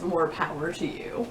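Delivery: ordinary talk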